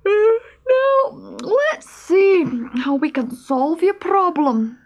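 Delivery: high-pitched, drowsy animal voice with hesitations; sleepy squirrel. Acted. Squirrel advises the stoat before going back to sleep, drowsily.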